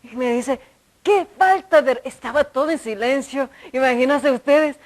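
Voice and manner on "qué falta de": loud, raised retold reprimand; imitated scolding shout. Older woman shouting in a silent holy hour, imitated shout. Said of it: "no sé por qué me gritó".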